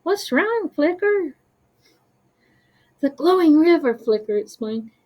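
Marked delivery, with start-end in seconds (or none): 0.0-1.4 high, gentle character voice; concerned sloth. Acted. Slothling answers worried friend. 3.0-4.0 high, loud nervous outburst. Acted. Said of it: "lights flickering nervously".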